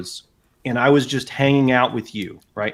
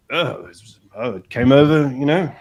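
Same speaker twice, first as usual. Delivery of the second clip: lightly put-on English accent. He fools Americans about moving over, casual English accent. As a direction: answer offhand in a mock English accent. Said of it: "when I do an English accent".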